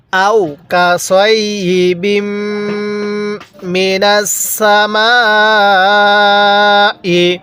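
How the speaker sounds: slow Quranic recitation with long-held vowels; measured reciting, pauses between phrases; model recitation. Shown as a demonstration. Teacher leading learners to read along, slow recitation. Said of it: "এবার এখান থেকে আমার সাথে পড়ুন".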